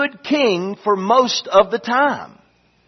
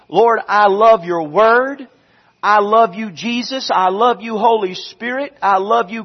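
prayer; 4 semitones higher, 4 dB louder than usual. Acted. Pastor praying aloud before the sermon, earnest and fervent. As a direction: pour out each I love you to God.

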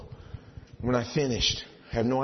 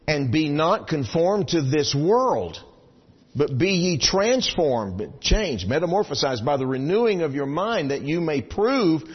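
slow, louder solemn reading; scripture reading; 5 semitones higher, 6 dB louder, slower than usual. Acted. Preacher reading Romans to congregation, slow solemn. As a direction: preach the verse deliberately.

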